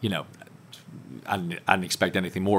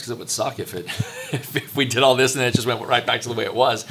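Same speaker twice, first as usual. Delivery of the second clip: real laugh breaking into the words; 4 semitones higher, 5 dB louder than usual. Real, not acted. Councilman joking to interviewer about politics sliding back, laughing. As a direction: chuckle through it, getting louder by the end. Jokes about change not lasting.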